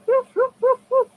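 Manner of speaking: high, loud yappy woofs; barking dog. Pretend. Voicing the dog toy for viewers, high yappy barks. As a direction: bark like a little toy dog.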